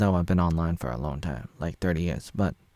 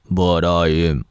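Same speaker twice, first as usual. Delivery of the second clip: mock gruff; 2 semitones lower, 8 dB louder, slower than usual. Vlogger parodying Flopper's contradiction, gruff loud. Alternating paradox voices.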